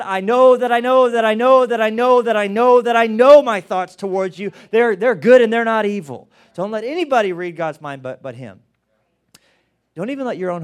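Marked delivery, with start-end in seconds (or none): 0.0-6.3 fervent voice of God declaring; building proclamation. Acted. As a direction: preach it up to a peak. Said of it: "God said".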